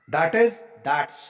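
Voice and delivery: ordinary delivery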